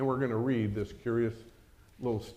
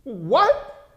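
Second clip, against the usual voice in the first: startled servant; 12 semitones higher, 8 dB louder than usual. Acted. Servant can't believe it.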